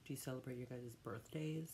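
ordinary delivery

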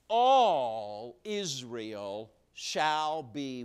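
very slow, loud, stretching each word; drawn-out fill-in prompt. Acted. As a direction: hold the line open so the crowd can finish it.

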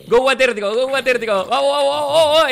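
loud, high chanting cheer; excited cheering. Real, not acted. Cheering on his friend before the big jump, loud and excited. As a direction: pump him up, shouting go go go.

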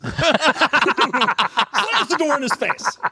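laughing. Real, not acted.

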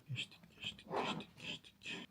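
hushed, whispered muttering; barely voiced, talking to yourself; whispering to self. Real, not acted. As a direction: whisper it absently while skimming pages. Skimming pages, muttering to self.

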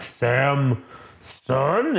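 halting, questioning, syllables split apart; dazed Hulk voice. Retold or imitated. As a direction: imitate a brute piecing a name together. Dazed Hulk recognising his therapist.

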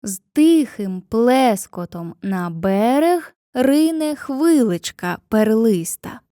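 slow, syllable by syllable, stressed beats marked; scanning the rhythm. Shown as a demonstration. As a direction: tap out each stressed syllable of «З тихим плескотом на берег рине». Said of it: "Наприклад".

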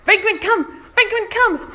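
high, loud calls after a runaway dog; screaming for the dog. Retold or imitated. Owner chasing loose dogs round the yard, shouting. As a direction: yell the dog's name to call her back. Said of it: "running around screaming like".

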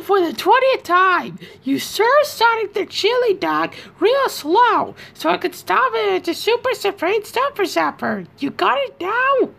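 imitating Scratch. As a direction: squawk it out as the bossy cartoon rooster. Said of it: "the scene where Scratch and Grounder are".

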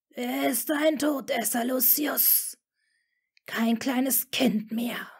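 the Dark Lord dismissing Lucius in a cold whisper; contemptuous hiss. A narrator's reading. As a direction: hiss down at the worried father with scorn. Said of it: "zischte er".

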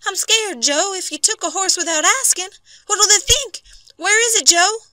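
very high, loud, frightened woman's voice; alarmed wife. A narrator's reading. Wife to husband over the taken horse, alarmed. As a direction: fret at him, high and panicky. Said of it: "in quick alarm".

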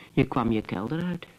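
ordinary delivery

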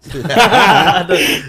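loud, high laugh at own joke; hearty amused laugh; burst of laughter. Real, not acted. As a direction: laugh out at your own punchline.

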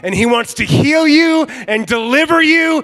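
high, slow, shouted climax; full-force preaching. Real, not acted. Preacher urges the church toward deliverance, full-force shout. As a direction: shout each promise out, stretching it high.

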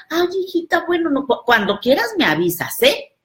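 high, yielding mom voice; meek mother. Retold or imitated. Mother gives in to her daughter, high meek voice. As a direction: give in sweetly to the child.

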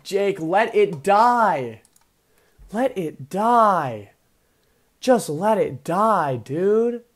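slow, drawn-out exasperated plea; exasperated pleading. Real, not acted. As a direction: stretch each word out, begging him. Begging opponent to let it faint.

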